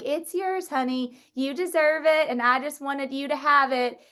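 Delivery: high, lightly voiced older lady, affectionate; sweet older lady. Affectionate lightly voiced elder. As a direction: Mo calling Dana up on stage, sweetly. Mo gives her the guitar.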